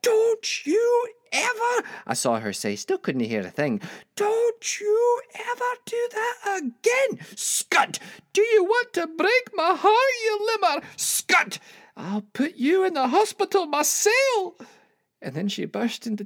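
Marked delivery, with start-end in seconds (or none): 0.0-1.9 high, loud, drawn-out yell; enraged mother's high-pitched bellow; furious scolding shout. Acted. Mother belts and scolds him. 4.1-14.5 high, loud, tearful tirade; full-force enraged yelling, pitched very high; furious scolding shout. Acted. Furious mother scolds, near tears.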